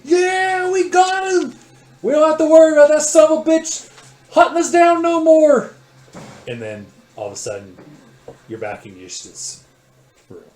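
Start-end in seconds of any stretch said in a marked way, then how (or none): 0.0-5.7 very high, full-blast victory shout; triumphant yelling. Acted. Other figure cheering the fallen enemy, triumphant high shouting. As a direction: holler it jubilantly at top volume. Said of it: "And you hear the other figure".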